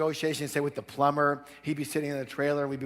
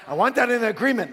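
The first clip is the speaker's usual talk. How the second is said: higher, louder demand; acted insistence; 9 semitones higher, 7 dB louder than usual. Plumber protecting himself from the father, acted loud insistence. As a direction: imitate someone firmly insisting.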